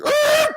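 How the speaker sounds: very high short yelp; sudden high yelp; mock scream. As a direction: imitate the scream before the crash. Imitated scream before crash.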